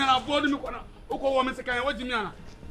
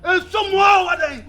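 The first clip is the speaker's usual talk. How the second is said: slow, loud, high shout; angry shout; 8 semitones higher, 5 dB louder, slower than usual. Acted. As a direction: yell it slowly and furiously. Elder shouts in argument.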